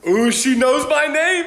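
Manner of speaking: high, slow, dreamy swoon; smitten. Retold or imitated. His thought on meeting the pretty dentist, smitten swoon. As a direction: savor it like a lovestruck kid.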